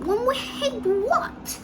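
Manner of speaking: high, loud question; alarmed cry. A narrator's reading. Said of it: "they cried".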